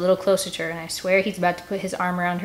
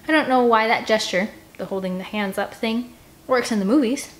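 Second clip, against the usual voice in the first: ordinary delivery, nothing marked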